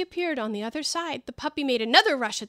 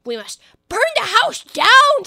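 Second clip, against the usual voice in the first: high, loud and slowed rabbit decree; rabbit's cry; 12 semitones higher, 11 dB louder than usual. Acted. Rabbit announcing to the crowd they'll burn the house, high and loud. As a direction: proclaim it to the crowd. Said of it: "said the rabbit's voice".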